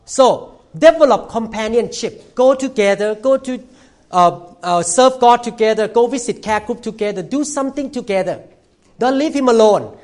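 high, loud exhortation; fiery preaching. Real, not acted. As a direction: urge the women on with fire. Preaching at full force.

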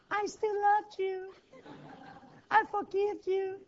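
soft, forgiving honey voice; gentle whisper. Acted. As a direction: coo it like a loving spouse. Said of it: "come with a gentle whisper".

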